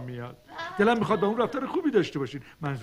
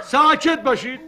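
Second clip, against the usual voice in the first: loud, higher call for silence; 8 semitones higher, 9 dB louder than usual. Acted. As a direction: raise the voice over the class. Teacher quiets noisy class. (با صدای بلند ساکت کردن کلاس)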